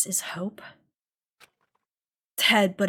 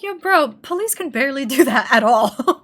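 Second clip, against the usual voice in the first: bro shout; 3 semitones higher, 7 dB louder than usual. Retold or imitated. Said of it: "I'm like".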